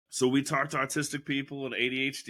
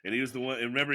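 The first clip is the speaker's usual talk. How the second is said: usual speaking voice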